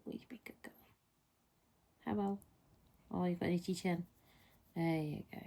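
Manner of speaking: soft, hushed cooing to a pet. Real, not acted. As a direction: murmur sweet nothings to the cat.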